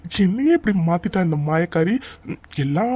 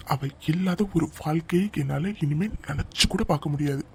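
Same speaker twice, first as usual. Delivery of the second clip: usual speaking voice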